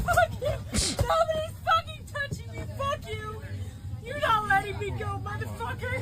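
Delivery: high, sobbing curses; crying curses. Real, not acted. Pinned-down passenger sobbing curses at the crew. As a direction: cry it out while you curse them. Restrained woman crying, cursing.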